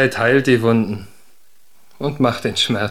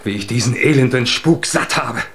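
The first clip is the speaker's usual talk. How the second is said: bitter scorn; 2 semitones higher, faster than usual. Acted. Huldbrand sneers at Undine's spirit family. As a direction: sneer at her kin.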